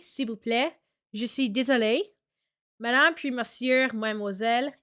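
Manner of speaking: reading phrasebook lines in Quebec French; plain reading, Quebec French accent. Shown as a demonstration. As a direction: lire en français québécois. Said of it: "you can hear my French accent 'cause I'll read something".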